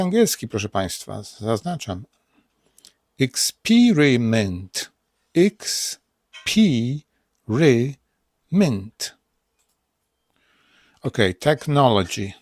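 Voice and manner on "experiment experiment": experiment stretched very slowly, ik-spi-ri-ment; slow American demo. Shown as a demonstration. Teacher drawing out the American pronunciation. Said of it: "Experiment to jest amerykański".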